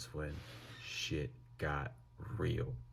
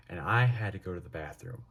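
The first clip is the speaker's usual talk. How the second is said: plain reading voice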